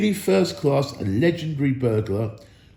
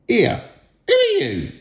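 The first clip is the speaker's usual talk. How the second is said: high squeak, much higher than the narrator; a squealing little purse voice; squeaky purse; 14 semitones higher, 5 dB louder than usual. A narrator's reading. The troll's purse squeaks at the thief, high and shrill. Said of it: "it squeaked".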